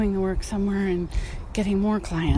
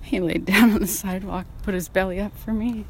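usual speaking voice

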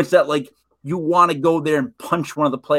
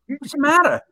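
higher, lightly voiced worried wife; wife's concern; 9 semitones higher than usual. Wife asking her teary husband what's wrong, lightly mock-worried. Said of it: "And she goes".

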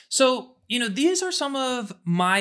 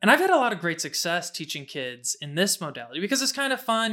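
normal talking voice

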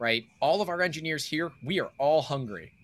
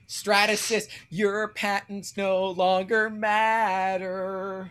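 gloating; 6 semitones higher, much slower than usual. Pretend. Gloating at company.

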